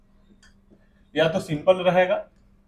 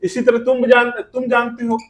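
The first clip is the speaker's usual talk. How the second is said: normal talking voice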